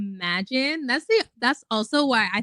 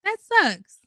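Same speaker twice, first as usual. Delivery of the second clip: louder, higher, exasperated complaint; exasperated outburst; 4 semitones higher, 5 dB louder than usual. Real, not acted. Vlogger to her viewers, an exasperated loud outburst. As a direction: loudly vent the frustration. Venting about wasting youth.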